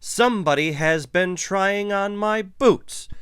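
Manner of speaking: loud, high-pitched booming outcry; Papa Bear. A narrator's reading. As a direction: boom it out as an outraged big bear. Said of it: "he boomed in his big bear voice".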